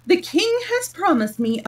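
high, loud pompous declaration; grand boast. A narrator's reading. Humpty Dumpty boasting to Alice about the king, grandly.

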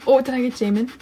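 ordinary talk, nothing marked

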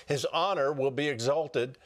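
ordinary talk

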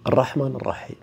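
ordinary delivery

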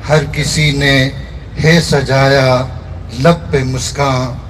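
measured mushaira recitation, line by line; poetry recitation. Acted. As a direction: recite the verse in separate phrases, lingering on the refrain. Recites own poem at a gathering. (मुशायरे के अंदाज़ में शायरी)

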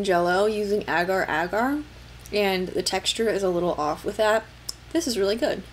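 talking while chewing; mouth full of jelly. Real, not acted. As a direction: spoon at the lips. Seen 2: spoon of red jelly enters mouth at 0.7, chewing after.

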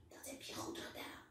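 soft whispered praise; mother whispering praise to her daughter. A narrator's reading. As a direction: whisper it close to her ear. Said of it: "fluistert mama".

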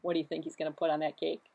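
plain reading voice

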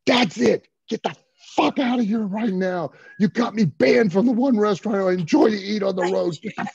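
loud, high-pitched re-enacted tirade; furious promo. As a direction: yell it like a wrestler cutting a promo. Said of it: "I just started cutting a promo on all of them".

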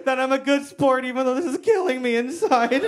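high, strained laughing-along voice; pretending to be a good sport while hurting; forced fake laugh. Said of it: "I'm just like".

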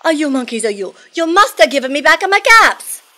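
loud, high peddler outburst; angry shout. Acted. Peddler stomping both feet at monkeys, acted angry shout. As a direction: shout like an exasperated storybook peddler. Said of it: "he shouted".

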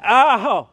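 high, hammed-up yelp of pain; mock ow. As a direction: yelp like it really stung. Paper slap, mock hurt.

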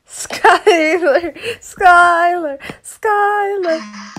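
calling the name high and teasing, drawn out; taunting sing-song. Pretend. Friend teasing the other by calling out the name, in a high taunting sing-song.

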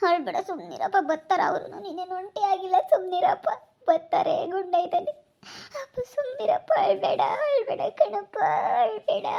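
high, weepy consoling that builds to a wail; rising sobbing plea; tearful pleading. Acted. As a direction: beg him through acted tears. Daughter tearfully consoles her crying father.

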